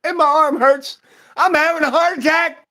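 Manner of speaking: very high, loud mock-panicked shout; hammed-up cry of medical distress; mock alarm. Joking with a friend about chest pains, mock alarm.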